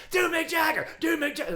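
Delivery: high, loud repeated demand; heckler shouting. Retold or imitated. Heckler demanding [name], loud high shout. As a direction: yell the request twice.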